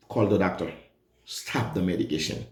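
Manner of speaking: normal talking voice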